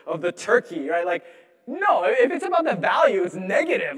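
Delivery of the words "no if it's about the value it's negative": mock rant. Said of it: "mock rant about junk".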